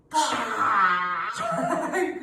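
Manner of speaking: loud breathy roar with tongue out; lion's breath roar. Shown as a demonstration. Teacher leads kids in a loud breathy lion roar.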